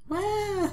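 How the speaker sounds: high fake sob; child wailing cry. Child Mai bursting into tears, high wail. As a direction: wail like a little kid.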